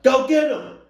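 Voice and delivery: very high, loud imitation yell; mock yell. Listener imitating mom sending uncle off, full-volume yell. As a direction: holler it out high, mimicking the mom. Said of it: "She yells out".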